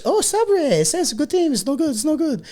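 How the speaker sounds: put-on very high, squeaky French accent. Fake French player judging the rival team, high French accent. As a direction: babble it in a hammed-up French accent. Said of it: "Can you do a French accent".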